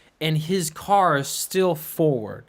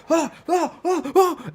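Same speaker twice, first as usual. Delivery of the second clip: much higher, mock panicked ah sounds; panicked groans; 13 semitones higher, slower than usual. Friend waking as car rolls, mock panic. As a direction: imitate a groggy panic. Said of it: "He was like".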